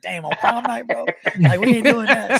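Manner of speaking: high, loud laughing disbelief at his brother. Retold or imitated. Telling his brother off on prom night, laughing in disbelief.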